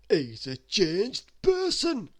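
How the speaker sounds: big, loud, drawn-out character voice; high, booming slow agreement; Mister Greedy. A narrator's reading. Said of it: "agreed Mister Greedy".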